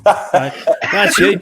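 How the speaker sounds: loud, laughing accent impression; loud mocking call. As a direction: call it out big and brash.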